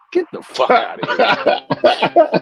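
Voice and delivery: high laughing mock shout; laughing mock yell. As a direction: yell it while cracking up. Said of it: "was like".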